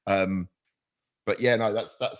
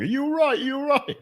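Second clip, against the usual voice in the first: high-pitched, squeaky mock-concerned voice; high little "are you all right" impression; mimicking worried partner. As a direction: jump way up high, imitating an anxious partner. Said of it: "significant other comes running, going".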